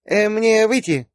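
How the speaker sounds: put-on high, drawn-out hesitant girl; unsure. A narrator's reading. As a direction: draw it out high and loud, unsure. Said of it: "неуверенно затянула краснодевица". (неуверенно, высоко и протяжно)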